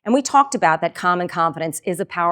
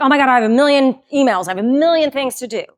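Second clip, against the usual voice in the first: hurried, louder overwhelmed groan; mock morning dread; 5 semitones higher, 5 dB louder, faster than usual. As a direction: rush it like the inbox is burying you. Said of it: "with dread".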